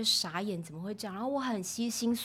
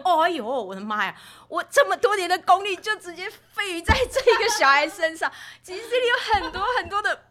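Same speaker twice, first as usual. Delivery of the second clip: loud, high comic lament; hammed-up dismay; 12 semitones higher, 13 dB louder than usual. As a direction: wail in mock horror at being found out. (夸张地高声哀叹)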